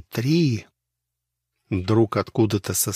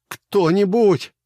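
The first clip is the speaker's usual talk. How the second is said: high, loud, slow plea for help; desperate call nearly crying; near-tearful cry; 11 semitones higher, 6 dB louder than usual. A narrator's reading. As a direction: beg to be heard, close to tears. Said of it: "чуть ли не плача простонал".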